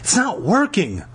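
high, whiny complaint; groaning. A narrator's reading. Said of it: "Lauren groaned".

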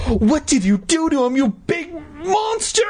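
put-on high outraged girl's shriek, climbing. A narrator's reading. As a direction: build to a screech on the last word. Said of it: "Mindy shrieked".

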